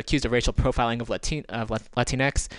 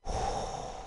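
long exasperated sigh; 10 dB quieter than usual. Real, not acted. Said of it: "gonna breathe".

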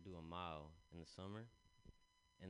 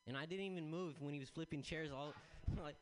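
usual speaking voice

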